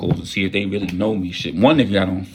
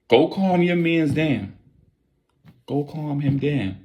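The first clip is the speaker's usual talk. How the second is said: slow pleading; 5 semitones higher, slower than usual. Retold or imitated. Pleading slowly.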